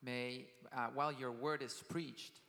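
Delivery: usual speaking voice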